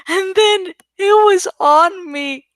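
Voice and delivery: ordinary delivery, nothing marked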